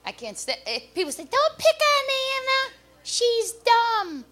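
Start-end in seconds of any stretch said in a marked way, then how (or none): 1.2-4.2 high mock-pleading voice of a defender. People sticking up for Anna Nicole, high mock pleading. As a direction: plead for her in a put-on, much higher voice.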